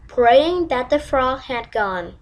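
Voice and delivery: ordinary delivery, nothing marked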